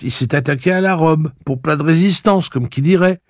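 put-on high, chatty girl voice; a girl gossiping with amused glee; young girl voice. Maria tells the dress story.

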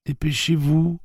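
voix d'enfant aiguë et pressée; petite voix pressante. A narrator's reading. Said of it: "les pressa la petite voix de Petit Blaine". (petite voix d'enfant pressante)

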